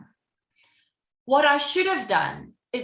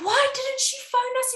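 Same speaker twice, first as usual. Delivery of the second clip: much higher, mock outraged question; mock indignant nurse; 13 semitones higher than usual. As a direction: ask it like an affronted nurse, hammed up. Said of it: "She's like".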